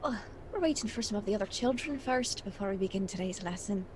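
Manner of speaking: a soft, lightly attempted Irish accent; teacher speaking in a faint Irish accent. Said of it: "she'll try to do an Irish accent".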